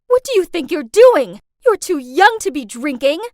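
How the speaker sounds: high, loud shocked yell; mom screaming. Retold or imitated. Mom catching daughter sipping beer, loud screaming reprimand. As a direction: catch her in the act and scream. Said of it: "my mom screamed out".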